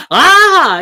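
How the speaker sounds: short high startled scream, imitating the officer; startled shriek. Officer shrieks at mention of gun.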